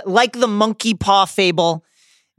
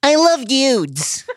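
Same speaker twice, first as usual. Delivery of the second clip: slow, higher mock Baltimore accent; 6 semitones higher, slower than usual. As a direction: stretch it out in playful Baltimore speak. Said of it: "really hear the Baltimore accent come out".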